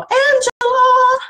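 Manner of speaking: Angela in a squeaky high goofy voice; silly cartoon squeal of Angela. Shown as a demonstration. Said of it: "you just get goofy with it".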